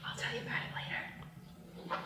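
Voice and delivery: soft unvoiced whisper in her ear. [name] whispering a secret to Chloe, hushed whisper. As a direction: breathe it secretly, no voice. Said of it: "whispers to her".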